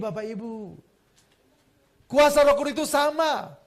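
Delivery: ordinary talk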